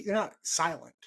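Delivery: ordinary talk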